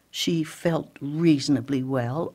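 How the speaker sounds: ordinary delivery, nothing marked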